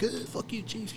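high, soft, squeezed jeer; mock defiant. Imitating the crew once the chief leaves the room, soft mock jeer. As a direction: toss it off quietly behind his back.

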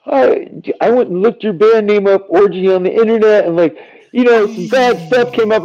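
son's voice retelling his search, high and put-on; imitating a child. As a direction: act out a worried kid confessing. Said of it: "And he goes".

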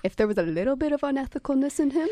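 normal talking voice

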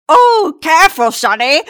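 put-on high, loud startled old man. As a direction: yelp it like a jostled old man. Old gentleman bumped in crowd.

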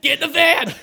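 high, loud getaway shout; hammed-up shout to hurry; mock urgent shout. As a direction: yell it like the cops are coming. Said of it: "Get in".

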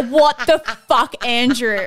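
laughing through the words, slowly; disbelieving laugh at his antics. Real, not acted. Laughing at the clip.

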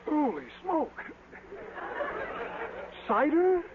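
wide-eyed surprise at the strong drink; startled gasp. Acted. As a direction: caught off guard by the burn. Reacting to strong cider.

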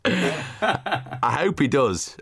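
slow, laughing through the words. Real, not acted. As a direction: chuckle while you say it. Laughing at Rooty joke.

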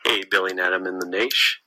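ordinary delivery

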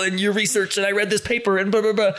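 high, rushed mock gushing; word-vomiting fangirl; gushing fan. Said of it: "absolutely word vomiting".

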